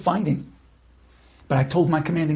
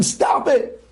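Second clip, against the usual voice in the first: much higher, annoyed shouting; irritated soldier shouting him down; exasperated yell; 6 semitones higher than usual. Retold or imitated. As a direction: tell him off, fed up. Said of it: "I tell him".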